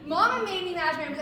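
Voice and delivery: quick, bright cheerful run-through; happy. Shown as a demonstration. Coach previewing the happy version of the line. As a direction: rattle it off gladly.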